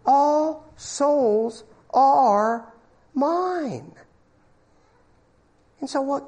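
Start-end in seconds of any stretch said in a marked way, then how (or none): 0.0-3.9 very slow, drawn-out voice of God; solemn voice of God. Acted. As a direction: let God claim every soul, slowly. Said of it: "says God".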